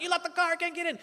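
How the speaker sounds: high-pitched mock panic; frantic dad. Comic as dad berating his daughter by phone, frantic. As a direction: imitate a worked-up dad on the phone.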